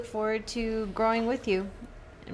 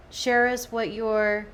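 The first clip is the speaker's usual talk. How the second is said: ordinary talk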